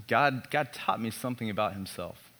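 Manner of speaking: ordinary talk, nothing marked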